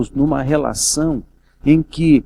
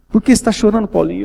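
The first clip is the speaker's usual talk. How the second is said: higher, louder anxious mother; a mother pleading, higher and louder; worried mother asking; 7 semitones higher, 4 dB louder, faster than usual. Retold or imitated. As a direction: ask the son why he cries. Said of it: "E chorando também".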